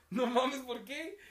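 loud, high mock disbelief; imitating shocked reaction. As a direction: echo the friend's shocked outburst. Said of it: "así como tú quedaste ahorita". (imitando la sorpresa del amigo, fuerte y agudo)

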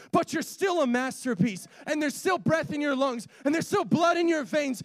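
building to high, forceful exhortation; rousing preaching. Real, not acted. Preacher assuring congregation they still have purpose, rousing build.

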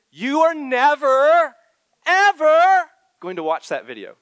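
loud, high preacher's cry; preaching at full force. Real, not acted. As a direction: drive it home, almost shouting. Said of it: "Are we preaching?".

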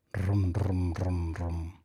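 low rolled stomach rumble; tummy rumbling noise. A narrator's reading. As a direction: imitate a growling belly, low. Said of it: "le sonaban las tripas". (ruido de tripas)

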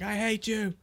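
high-pitched mock outburst; imitated cursing rant. Friend retelling Jon Jones's arrest meltdown, high mock outburst. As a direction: act out a tantrum at the cops. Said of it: "he's, like, swearing at the cops".

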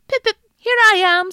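high, loud, quick piping call; high squeaky call. Acted. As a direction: pipe up fast like a little boy. Boy calls from hiding.

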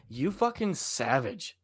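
slow, low mock reproach; retold reproach. Podcaster quoting a stranger's comeback, mock reproach. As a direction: imitate someone drawing out an insult, softly. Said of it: "someone's like".

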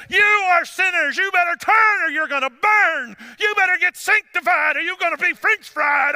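high, loud hammed-up hellfire shouting; mad preacher. Preacher imitating mad preachers for his congregation, hammed-up furious shouting. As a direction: imitate an angry preacher at full shouting pitch. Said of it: "seems like the preacher is just mad".

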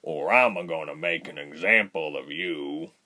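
deep, rumbling threat; heavy, low-voiced warning. A narrator's reading. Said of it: "Bear said in a low, rumbling voice".

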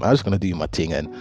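usual speaking voice

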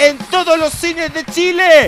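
movie trailer voice. Acted. Mock trailer release announcement.

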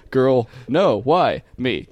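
normal talking voice

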